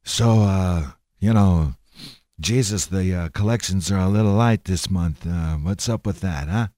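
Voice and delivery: low, lightly voiced mob boss; mock mafia don questioning an underling; casual low menace, hinting at the Godfather; Godfather God. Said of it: "God sitting there and he's going around".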